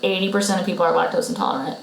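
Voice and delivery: normal talking voice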